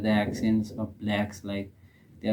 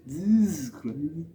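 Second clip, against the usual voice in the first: high, drawn-out mimicked exclamation; amused mimicry, pitched up and slow; mimicking a comedian; 7 semitones higher, slower than usual. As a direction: stretch it out slowly in imitation. Mimics the clip just played.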